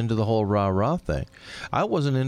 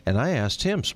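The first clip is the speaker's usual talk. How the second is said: usual speaking voice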